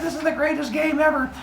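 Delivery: high, squeaky mock glee; hammed-up childlike enthusiasm; Zeus. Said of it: "Zeus is like".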